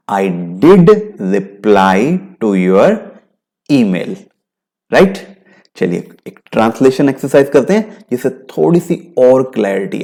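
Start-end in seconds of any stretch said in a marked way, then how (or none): 0.1-4.2 "did" held and stressed, very slow. Shown as a demonstration. Teacher countering blame, stressing did. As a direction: weigh down the word "did". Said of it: "emphasise करके बोल रहा हूं".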